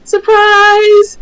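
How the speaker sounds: high, loud surprised cry; excited squeal. Acted. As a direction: burst in with a squeal of delight. NPC pops up cheering.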